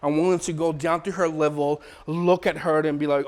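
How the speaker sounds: ordinary delivery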